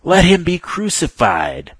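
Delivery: the crowd drowning out Pilate; crying out exceedingly. Acted. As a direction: drag each word out in a furious roar. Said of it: "they cried out exceedingly".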